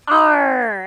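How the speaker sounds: loud pirate growl; pirate arr. Retold or imitated. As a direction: bark it like a pirate.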